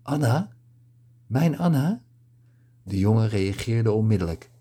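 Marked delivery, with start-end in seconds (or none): 0.0-0.5 higher, rising disbelieving question; a lightly voiced gasp of recognition; stunned surprise. A narrator's reading. Kibbe hears his lost love's name, stunned. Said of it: "Kibbe's mond was opengevallen". 1.3-2.0 much higher, rising, breathless question; the name hits him hard, lift it high; astonished disbelief. A narrator's reading. Kibbe realises the woman is his Anna, astonished. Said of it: "Kibbe's mond was opengevallen".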